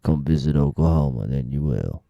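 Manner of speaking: very low, measured mock ad read; commercial voiceover. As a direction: drop deep like a tourism commercial announcer. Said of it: "be like one of those commercials".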